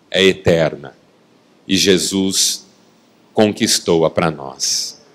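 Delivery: low, measured delivery with long pauses; hushed-down, grave sermon close; solemn preaching. Real, not acted. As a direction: let each phrase settle reverently.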